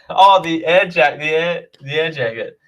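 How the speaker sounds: normal talking voice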